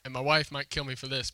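usual speaking voice

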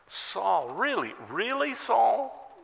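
high, pleading disbelief; imitated exasperated questioning; incredulous appeal. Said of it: "That's what he's saying".

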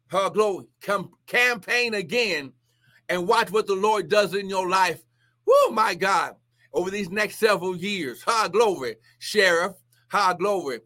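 ordinary delivery

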